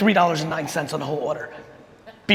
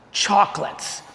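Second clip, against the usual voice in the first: ordinary delivery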